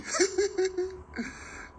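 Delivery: a high scornful burst of laughter; mocking laugh. Real, not acted. Laughing off critics who say Igbos will come for him, high scornful laugh. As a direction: laugh them off high and derisive.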